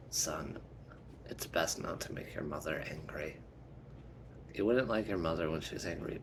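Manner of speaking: low-pitched, understated dad voice; father's aside. Acted. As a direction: warn your son confidentially, voice dropped. Father quietly warns his son.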